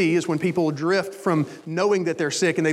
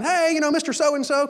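higher-pitched friendly doctor, imitated; doctor's greeting; 7 semitones higher than usual. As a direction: hail a patient as his doctor. Voices doctor greeting the patient.

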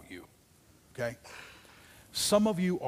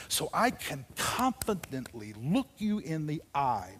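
normal talking voice

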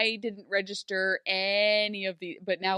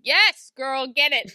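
loud, higher hype; excited cheer; 5 semitones higher, 10 dB louder, much slower than usual. Pretend. Cheering Regina's invitation, loud and excited. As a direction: cheer her on like a fan.

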